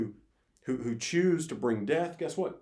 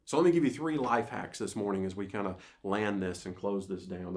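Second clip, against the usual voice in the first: ordinary talk, nothing marked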